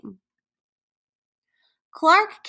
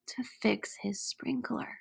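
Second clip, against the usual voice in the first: whisper; 14 dB quieter than usual. Acted. Whispered aside while reading.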